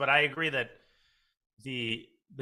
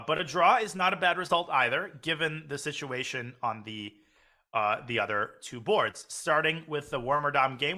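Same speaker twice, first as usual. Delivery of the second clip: ordinary talk, nothing marked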